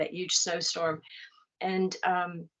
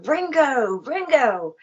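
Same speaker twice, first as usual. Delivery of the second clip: calling the name across the street; 6 semitones higher, 8 dB louder, slower than usual. Retold or imitated. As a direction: shout it to someone far off.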